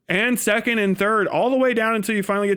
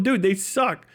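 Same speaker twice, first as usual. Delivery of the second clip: ordinary delivery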